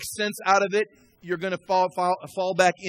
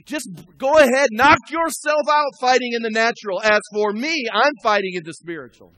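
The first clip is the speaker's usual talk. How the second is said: raised, emphatic preacher's delivery; forceful preaching; 5 semitones higher, 5 dB louder than usual. Real, not acted. Pastor telling the congregation how he fights in the spirit, forceful preaching. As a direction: preach it with conviction, punching key words.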